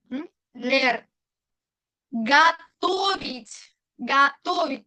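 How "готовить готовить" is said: готовить with the second O stretched and stressed. Shown as a demonstration. Teacher modelling a new Russian verb, stressed second O in готовить. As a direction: lean on the TO in готовить. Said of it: "tilde en la segunda O".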